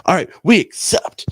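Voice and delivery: loud, slow retold proclamation; wrestling mic announcement. Wrestler answering on the mic in the main event, slow loud proclamation. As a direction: declare it slowly, high and loud.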